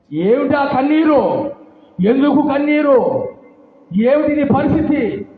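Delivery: high, loud, slow demand about the tears; loud questioning. Retold or imitated. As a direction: boom the questions at her like a stern priest. Eli loudly questions weeping woman.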